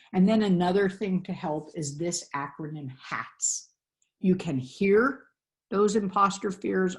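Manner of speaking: ordinary delivery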